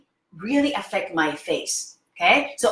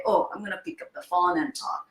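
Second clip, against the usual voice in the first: lower, softer mock caller voice; mock phone caller; 4 semitones lower, 6 dB quieter, faster than usual. Teacher imitating someone on the phone, lower and softer. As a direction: toss it off as a phone caller, lightly mocking. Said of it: "you don't talk like this".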